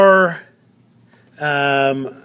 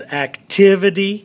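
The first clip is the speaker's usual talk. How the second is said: "activity" with TIV punched; accent the second syllable of "activity"; demonstrative ac-TIV-ity; stress on TIV; 4 semitones higher, 3 dB louder than usual. Shown as a demonstration. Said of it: "The accent of the syllable is".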